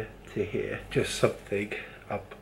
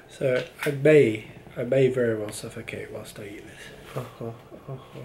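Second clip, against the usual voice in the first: normal talking voice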